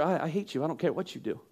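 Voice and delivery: low, soft and detached; quiet, heartless parent brushing off the kid; cold dismissal. Retold or imitated. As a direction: let it drop, cold and uninterested. Voicing uncaring parent to kid.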